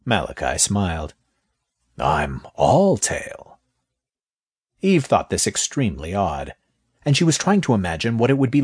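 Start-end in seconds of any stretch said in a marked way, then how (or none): 2.0-3.6 very slow, low serpent; snake voice. Acted. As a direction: savor each word like a snake. Snake answers smiling.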